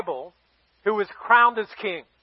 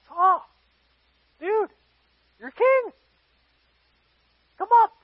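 high-pitched, halting coaxing; acted awkward prodding, pitched way up; coaxing someone out; 7 semitones higher than usual. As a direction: call to someone hiding, short phrases with long pauses. Coaxing hidden king out of baggage.

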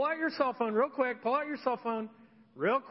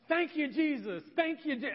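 mock charismatic praise; 5 semitones higher than usual.

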